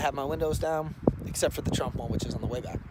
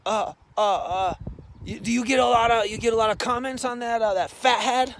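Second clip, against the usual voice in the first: higher, louder hesitant bro voice; nervous bro; 9 semitones higher, 7 dB louder than usual. Retold or imitated. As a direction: stammer it out like an awkward guy. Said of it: "And he's like".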